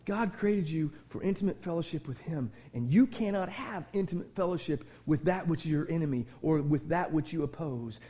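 ordinary talk

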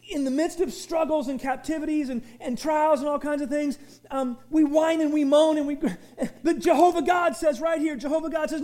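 ordinary talk, nothing marked

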